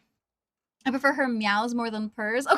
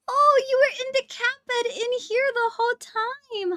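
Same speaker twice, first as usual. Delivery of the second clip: high gasping delighted discovery; surprised delight; 10 semitones higher, 3 dB louder than usual. Real, not acted. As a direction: gasp as if finding a hidden pet. Finds cat in bed.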